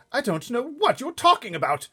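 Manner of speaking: very high, loud irritated king; testy King. Acted. King of Sweden growing testy, high and loud. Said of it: "growing testy".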